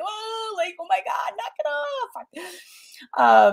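high mock-frazzled mom scolding kids; frantic high-pitched plea to unruly toddlers; exasperated young mom. Young mom in the grocery store snapping at her kids. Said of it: "I'd be like".

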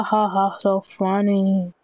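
deadpan mock laughing; sarcastic fake laugh. Fake laughter at unfunny talk.

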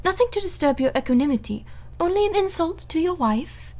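lightly brittle cheer, a little fast; mock-light, hiding the sting; forced gaiety. Marguerite to her husband, forced gaiety. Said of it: "sounded somewhat forced".

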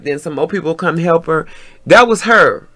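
ordinary delivery

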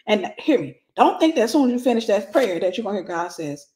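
ordinary talk, nothing marked

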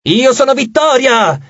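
high, slow rival proclamation; crying out. A narrator's reading. Other half of the split goddess naming herself, crying out. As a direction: answer the rival with a high, stretched cry. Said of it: "gridò quella a destra".

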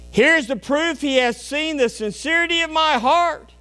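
high, loud revival preaching; fiery preaching. Acted. Preacher to congregation, loud fervent preaching. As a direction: preach it hard and high to the pews.

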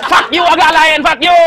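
playful indignant yell; mock angry outburst. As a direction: curse them out loudly for laughs. Mock cursing at rival comedians.

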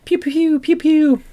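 high-pitched toy gun pews; imitated gunfire. Host mimicking Tommy guns after a game blurb, high pews. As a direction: fire off silly high laser pews.

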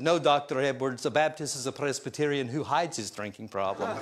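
a light Scottish brogue, imitating the pastor. Young [name] retorting to Doctor Edwards in a Scottish brogue. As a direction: tease him back in a mild Scottish accent. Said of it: "I said back to him in his brogue".

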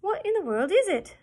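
high, loud amazed question; astonished. A narrator's reading. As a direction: gasp it out at the strange beast. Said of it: "asked John Dolittle, gazing at the strange creature".

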